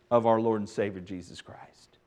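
hushed whisper of scripture; reverent whisper. Real, not acted. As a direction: breathe the holy name softly. Reverent end of scripture verse.

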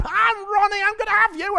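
hammed-up northern accent; Ronnie Pickering northern accent. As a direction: rant like a northern hothead in a road rage. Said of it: "only got one northern accent in my toolkit".

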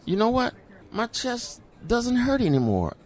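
high, soft marveling voice; telling it high and quiet in awe; amazed. Retold or imitated. Amazed pain has gone.

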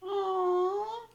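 high, loud drawn-out aww. Real, not acted. Cohost reacting to the sweet photo, a high cooing aww. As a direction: melt over the photo.

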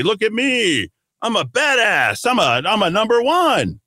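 high-pitched show-off, hammed up; mock boasting. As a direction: brag like someone desperate to be admired. Mocking people who brag about connections.

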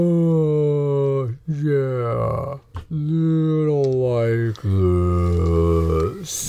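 slow, drawn-out dopey giant; ponderous beast agreeing; slow giant creature. Acted. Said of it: "Momo's like".

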